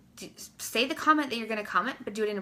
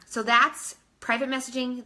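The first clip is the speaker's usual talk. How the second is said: ordinary delivery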